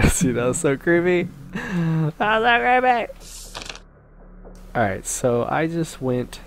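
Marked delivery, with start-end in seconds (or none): 1.5-3.1 slow, high, eerie drawl on "so"; spooky voice. Pretend. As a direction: drag out the so like a ghost story. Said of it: "repeats in spooky voice".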